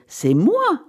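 high, insistent claim; crying out. A narrator's reading. Wife insisting she will go herself, crying out. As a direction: protest that it must be you. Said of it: "s'écria-t-elle".

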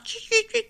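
high, spoken bird chirps; sparrow chirping. A narrator's reading. Sparrows sounding the alarm about a bear, high chirping. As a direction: imitate sparrows with quick high cheeps. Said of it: "firent les moineaux en poussant leur".

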